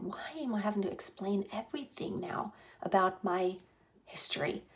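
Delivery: unvoiced, secretive whisper; hushed inner voice; whispered thought.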